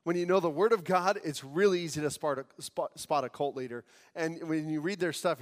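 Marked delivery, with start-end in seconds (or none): none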